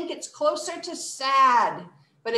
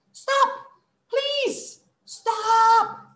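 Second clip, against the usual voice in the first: very high, louder mock plea; playful begging; 12 semitones higher, 5 dB louder, much slower than usual. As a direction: beg a wheel to stop, hammed-up. Begging the spinning wheel to stop.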